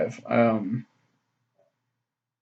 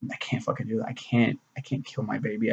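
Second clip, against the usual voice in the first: much softer, half to himself; quiet inner thought; 10 dB quieter than usual. Retold or imitated. Recalling his thoughts when his wife got pregnant, much softer. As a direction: murmur it like a private resolve.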